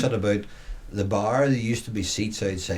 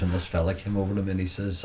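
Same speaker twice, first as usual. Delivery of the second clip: ordinary talk, nothing marked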